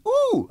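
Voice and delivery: high, loud exaggerated ooh; mock ooh. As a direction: gasp like an extra mugging for camera. Acting out commercial reaction faces.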